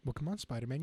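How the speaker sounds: ordinary talk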